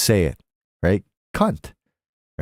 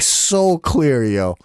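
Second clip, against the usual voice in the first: ordinary talk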